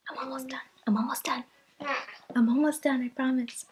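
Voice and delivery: soft sing-song reassurance. Real, not acted. Soothing baby while filming.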